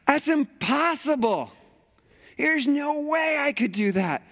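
high, disbelieving protest; incredulous. Retold or imitated. Churchgoer protesting the command, incredulous. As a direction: throw up your hands at it. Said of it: "are like".

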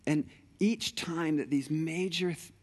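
ordinary talk, nothing marked

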